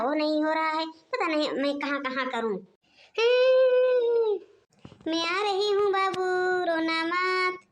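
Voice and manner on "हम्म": soothing hum. Acted. Grandmother hums to soothe baby.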